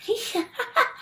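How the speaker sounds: high breathy mock cackle. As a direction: cackle like a mean girl. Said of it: "they start cackling".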